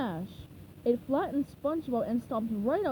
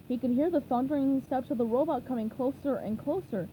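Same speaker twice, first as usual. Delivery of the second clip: usual speaking voice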